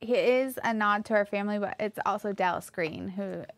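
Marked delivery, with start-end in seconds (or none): none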